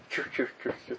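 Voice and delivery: soft voiceless puffs in rhythm; whispery little swishes, one per step; quick breathy footstep noises; imitated walking swish.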